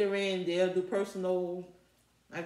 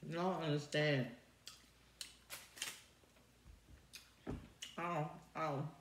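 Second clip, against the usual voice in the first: speaking between bites; chewing while talking; 2 semitones lower, 6 dB quieter than usual. Real, not acted. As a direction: mouth full of food. Seen 5: Talks while chewing food, takes new bites around 4 s.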